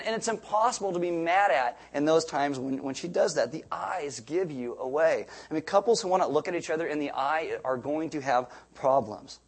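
ordinary talk, nothing marked

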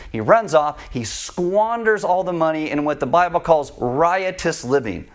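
ordinary talk

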